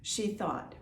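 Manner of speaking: usual speaking voice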